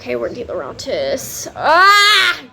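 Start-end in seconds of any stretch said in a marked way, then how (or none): 1.6-2.4 high loud excited shriek; short loud lovesick scream; fangirl shriek. Real, not acted. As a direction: squeal like a swooning fan. Fangirl squeal over character.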